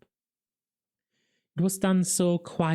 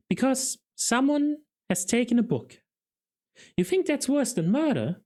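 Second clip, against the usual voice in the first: put-on high, disbelieving young constable; can't believe a book matters more than murder; shocked youthful voice; incredulous; 11 semitones higher than usual. A narrator's reading. Carrot incredulous, much higher.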